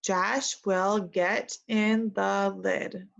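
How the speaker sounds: very slow, word by word; very slow model reading. Shown as a demonstration. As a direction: drag each word out for children to copy. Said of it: "Repeat after me".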